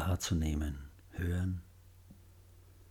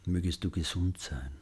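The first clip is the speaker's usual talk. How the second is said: much softer repeat; hushed loving echo; 6 dB quieter than usual. Shown as a demonstration. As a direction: echo the wish very quietly. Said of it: "Wiederholen Sie die Sätze sanft und liebevoll".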